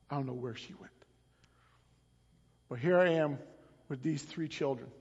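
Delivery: soft low storytelling of a husband left alone; hushed lament. Acted. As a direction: let it sink into quiet grief. Hushed retelling as abandoned Hosea.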